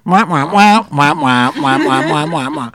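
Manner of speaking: Charlie Brown adult wah wah; loud sing-song wah wah, like a muffled trumpet; muffled wah wah talk. Retold or imitated. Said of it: "it's like the Charlie Brown parents".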